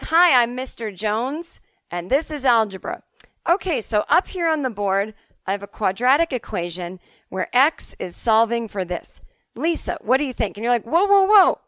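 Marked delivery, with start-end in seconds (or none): 0.0-10.6 higher-pitched teacher launching straight into the lesson; eager first-day math teacher; brisk keen teacher. Acted. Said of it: "the teachers who get right into it". 10.8-11.6 put-on high, loud, flustered teenager; overwhelmed pupil, pitch jumping up; alarmed student protest. Overwhelmed student halts the rushing teacher.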